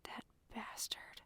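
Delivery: very soft, slow voiceless whisper; bitter whisper. A narrator's reading. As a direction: curse under your breath. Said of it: "Jeremy whispered".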